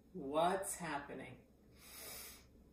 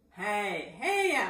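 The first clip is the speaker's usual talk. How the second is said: nasal voice; 3 semitones higher, 6 dB louder than usual. Shown as a demonstration. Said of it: "some people talk in their nose".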